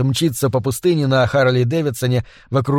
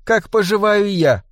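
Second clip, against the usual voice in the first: higher-pitched, overdone caring tone; mock-solicitous, pitched up and cloying; caricatured concern; 7 semitones higher than usual. A narrator's reading. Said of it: "карикатурно заботливым тоном". (карикатурно заботливый тон)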